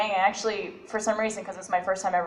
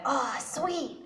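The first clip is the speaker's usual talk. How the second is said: Ruby excitement; 7 semitones higher, 7 dB quieter than usual. Retold or imitated. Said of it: "I went".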